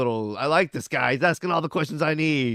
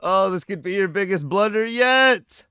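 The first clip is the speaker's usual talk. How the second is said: loud, high cartoon villain lament; exaggerated cartoon intonation; 8 semitones higher, 11 dB louder than usual. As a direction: overplay it like a cartoon character. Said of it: "mocked some, like, cartoon character's voice".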